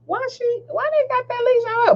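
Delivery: high, quick mock-baffled question; judgmental disbelief. Nosy shopper questioning the leash, incredulous. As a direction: play the nosy onlooker up high. Retold judging reaction.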